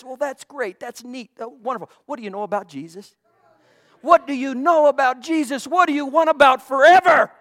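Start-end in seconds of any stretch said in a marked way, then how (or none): 2.1-3.1 quick and soft. Real, not acted. Repeated rhetorical question. 4.0-7.3 louder challenge. Real, not acted. Repeated rhetorical question.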